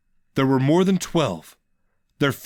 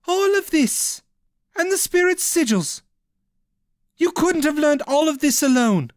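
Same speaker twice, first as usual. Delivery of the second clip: observing. Acted. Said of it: "Ishel observed".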